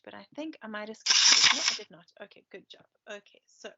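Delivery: plain reading voice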